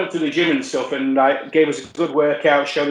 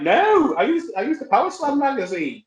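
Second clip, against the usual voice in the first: high-pitched defensive protest; protesting denial; 10 semitones higher than usual. Retold or imitated. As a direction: squeak out your innocence. Acts out his protesting denial.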